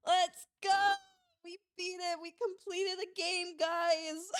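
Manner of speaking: high, loud triumphant cheer; excited cheer. Real, not acted. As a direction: celebrate the win to the chat. Celebrates finishing the game.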